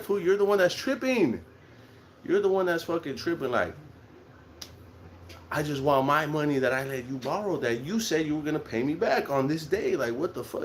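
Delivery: high-pitched, mildly heated retelling of a quarrel; exasperated retort. Retold or imitated. Retelling a row with the friend who owes money, exasperated. Acted quarrel over unpaid loan.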